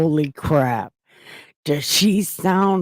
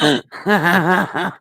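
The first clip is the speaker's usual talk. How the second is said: amused laugh; 4 dB louder than usual. Real, not acted. Streamer reacts to testimony.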